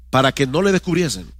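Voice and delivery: usual speaking voice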